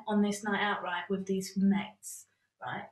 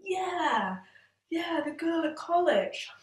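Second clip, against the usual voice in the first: nervous agreement; 8 semitones higher, slower than usual. Retold or imitated. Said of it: "I'm there like".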